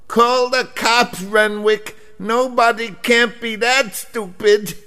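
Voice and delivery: high mock squeak, a touch slowed; squeaky woman voice. Clerk calling to Renwick from the back, squeaky voice. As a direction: chirp it tiny and high.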